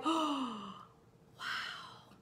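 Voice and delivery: gasp, then a whispered wow; hushed awe. Retold or imitated. Coach acts out seeing the possibility, hushed awe. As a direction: act out breath-taken wonder, very soft.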